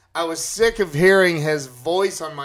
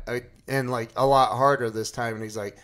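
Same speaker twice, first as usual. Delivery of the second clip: usual speaking voice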